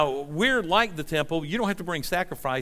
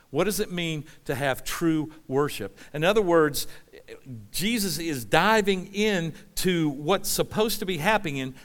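normal talking voice

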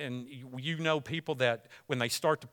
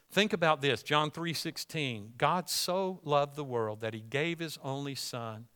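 usual speaking voice

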